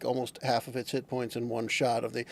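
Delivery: usual speaking voice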